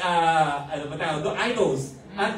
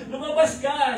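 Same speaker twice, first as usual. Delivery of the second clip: duwende voice; 7 semitones higher than usual. Retold or imitated. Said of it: "iba yung boses, parang ano, parang duwende".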